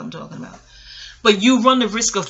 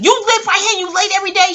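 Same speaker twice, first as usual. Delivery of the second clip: mock teacher scolding, higher and louder; angry teacher; 11 semitones higher, 7 dB louder than usual. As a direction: imitate the outraged teacher snapping the question. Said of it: "Miss Lewis said".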